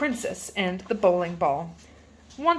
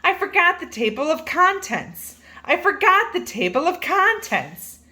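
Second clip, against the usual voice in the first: cry; 7 semitones higher than usual. A narrator's reading. Narrator interrupting the story.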